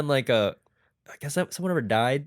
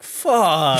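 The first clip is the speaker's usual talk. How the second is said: mock exclaim; 3 semitones higher, 8 dB louder than usual. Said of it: "What if the manufacturer were like".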